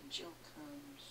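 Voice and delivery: breathy hushed repeat; whispered. Shown as a demonstration. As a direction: whisper it close to the mic. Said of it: "repeated take quietly".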